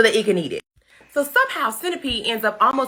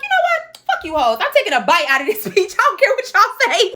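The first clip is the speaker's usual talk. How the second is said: high-pitched mock defiance; hammed-up, high defiant outburst; defiant child; 8 semitones higher than usual. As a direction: play a fed-up boy standing up to his aunts. James voiced defying his aunts.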